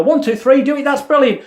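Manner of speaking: high, bright countdown and praise; energetic coaching. Shown as a demonstration. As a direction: cheer them on loudly. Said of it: "you go".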